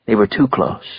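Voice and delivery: plain reading voice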